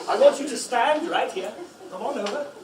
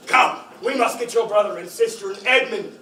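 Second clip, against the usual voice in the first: ordinary talk, nothing marked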